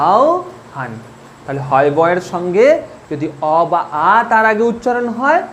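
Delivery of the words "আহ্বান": আহ্বান as ao-han, high start then falling; correct pronunciation demo. Shown as a demonstration. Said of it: "এরপর কি উচ্চারণ করবে". (আহ্বান, উঁচু "আও", তারপর "হান")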